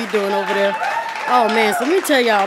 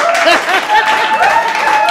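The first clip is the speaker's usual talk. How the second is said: very high laughing shriek; 4 dB louder than usual. Real, not acted. Comic laughing after hyping the women in the crowd.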